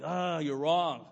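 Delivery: slow, dismissive jab; mock prideful retort. Husband imitating his prideful retort to wife, slow and dismissive. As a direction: drag it out like a stubborn husband. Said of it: "stay prideful 'cause I was still upset".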